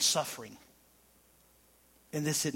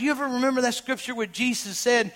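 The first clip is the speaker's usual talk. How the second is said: preaching; 5 semitones higher, 7 dB louder than usual. Acted. Preaching continues loud.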